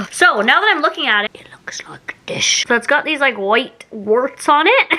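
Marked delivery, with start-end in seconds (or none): 1.2-2.4 breathy whispered aside, leaning in close to the mic. Real, not acted. Vlogger whispering to her viewers about the odd-looking cracker, whispered. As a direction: hush right down as if sharing a secret. Sudden hush over the candy.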